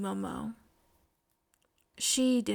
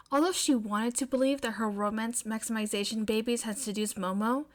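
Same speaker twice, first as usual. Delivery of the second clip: ordinary delivery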